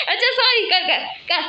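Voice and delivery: high voice breaking with giggles; laughing apology. Real, not acted. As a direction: say sorry through leftover laughter. Apologises while laughing at brother. (हँसते हुए माफ़ी माँगना)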